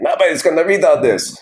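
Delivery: put-on quoting dad in a high Russian accent. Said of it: "I only have one accent. It's a Russian accent".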